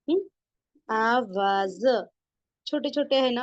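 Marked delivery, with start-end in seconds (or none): 0.9-2.1 very slow आवाज with long aa vowels; syllable-by-syllable reading. Shown as a demonstration. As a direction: draw out आवाज, ending on a separate za. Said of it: "ध्यान दे और आवाज देखे".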